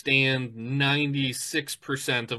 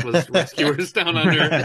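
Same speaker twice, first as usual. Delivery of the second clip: laughing; 4 semitones higher, 5 dB louder than usual. Real, not acted. Laughing memory.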